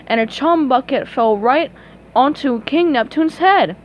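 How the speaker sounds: plain reading voice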